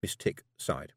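usual speaking voice

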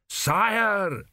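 priest's alarmed bellow. A narrator's reading. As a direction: bellow it across the yard. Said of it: "Dios bellowed".

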